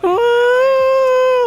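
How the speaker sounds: high loud whoop; cheering shout. Real, not acted. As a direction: shout for joy. Player cheers loudly.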